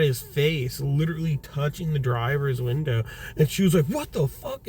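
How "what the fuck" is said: high, softer mock shock; imitated startled reaction. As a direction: mimic a startled wife's exclamation. Wife startled by man at window.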